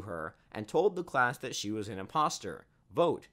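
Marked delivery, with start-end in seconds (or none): 2.9-3.3 higher, louder call-out; narrated shout. A narrator's reading. Student accusing the teacher before the class, shouted. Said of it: "I shouted".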